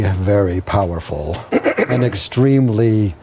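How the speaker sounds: low, measured vampire Count; the Count's deep, deliberate demand. Acted. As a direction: covet the necklace as a low-voiced vampire. Game master voices the Count.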